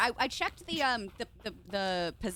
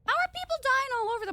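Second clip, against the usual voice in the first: surprised; 14 semitones higher, 5 dB louder, much faster than usual. Real, not acted. Startled outburst.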